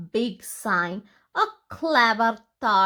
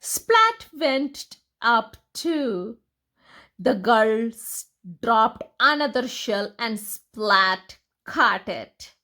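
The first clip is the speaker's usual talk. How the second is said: plain reading voice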